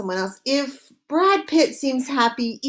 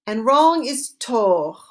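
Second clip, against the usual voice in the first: ordinary delivery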